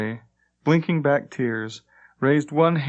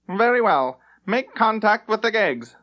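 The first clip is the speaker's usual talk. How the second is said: haughty captain; 10 semitones higher, 3 dB louder than usual. Acted. Captain gives order.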